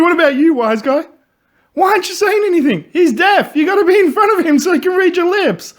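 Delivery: high, loud, laughing retold yell; mock yelling police chief. Retelling the interrogation scene, the chief yells, high and loud. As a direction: bark it like an impatient chief. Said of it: "He's like".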